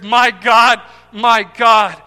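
loud cry of anguish from the pulpit; loud, impassioned lament. Acted. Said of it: "the anguish and cry".

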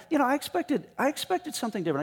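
high, fast indignant whine; mock outrage. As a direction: squeak it out like an offended official. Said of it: "he says".

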